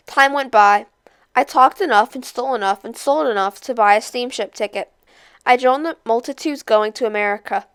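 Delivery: usual speaking voice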